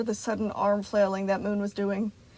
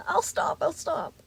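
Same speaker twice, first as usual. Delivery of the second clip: tearful pleading; 8 semitones higher than usual. A narrator's reading. Gregory crying.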